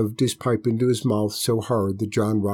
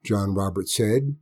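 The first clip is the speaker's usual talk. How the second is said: ordinary delivery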